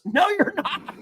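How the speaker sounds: high, laughing no; laughing refusal. Retold or imitated. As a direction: shut it down through a laugh. Mark Burnett shuts him down, laughing.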